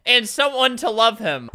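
ordinary talk

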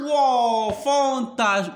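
loud, high, stretched-out wow; excited, drawn-out exclamation; amazed praise. Real, not acted. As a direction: gush slowly over a pupil's answer. Amazed praise for pupil's answer.

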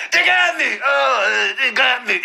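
high, cracking "They got me" through laughter; mock wounded whimper. Retelling the bloodied man, high laughing whimper.

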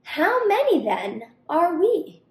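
high, loud chorus demand; loud high crocodile cry; crocs crying out. Acted. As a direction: shout up at the tree together. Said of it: "the crocodiles below cried out".

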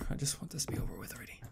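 fast, breathless whisper under the breath; nervous whisper. Real, not acted. Gamer alone in a dark hallway, nervous whisper. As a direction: hurry the words out in a hush.

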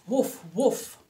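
loud, short woofs; dog's bark. A narrator's reading. Storyteller voices a dog for children, loud woofs. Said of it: "barked the dog".

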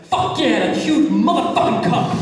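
loud-edged, high, gleeful cursing as the barista; pent-up outburst. Retold or imitated. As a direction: let the pent-up swearing burst out. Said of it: "seething in his soul the entire time to just say this".

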